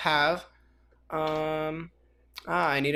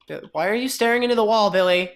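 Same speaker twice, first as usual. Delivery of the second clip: calling out; 5 semitones higher, 5 dB louder than usual. Pretend. Calling out to a sheep.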